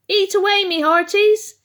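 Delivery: high, loud piratey cheer; a greedy mouse hollering to the others; gleeful pirate mouse. A narrator's reading. Mouse urges the others to feast, high pirate cheer.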